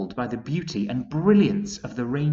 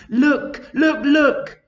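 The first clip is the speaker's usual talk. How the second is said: much higher, loud excited calls; ladybird shouting; 11 dB louder, slower than usual. A narrator's reading. Ladybird to the travellers, high loud excited calls. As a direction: call out, pointing in wonder. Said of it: "shouted the ladybird".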